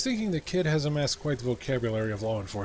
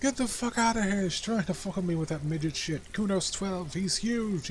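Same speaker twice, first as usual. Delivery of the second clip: a foul-mouthed kid mocking the cop; brash kid Kuno; 9 semitones higher than usual. Kuno rants at the detective.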